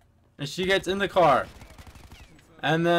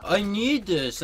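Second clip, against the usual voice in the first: slow, higher-pitched imitated plea; mock crying; 5 semitones higher, slower than usual. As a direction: drag out her tearful begging. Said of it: "just sat there and cried".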